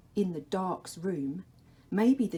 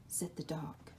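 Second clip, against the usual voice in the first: usual speaking voice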